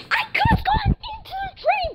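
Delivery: high incredulous voice; outraged squeak. Real, not acted. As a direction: squeal in disbelief. Outraged at mom.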